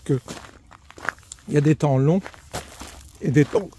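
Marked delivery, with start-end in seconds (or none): none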